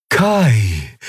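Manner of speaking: long drawn-out call. A narrator's reading. Eidolon spots long-lost Kai, calling his name drawn out. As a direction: call the name from afar, falling away.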